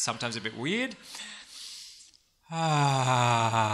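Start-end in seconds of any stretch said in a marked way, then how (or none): 2.5-3.7 loud open sigh; dramatic sigh. Shown as a demonstration. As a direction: let out a big audible sigh. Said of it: "It's going to look like this".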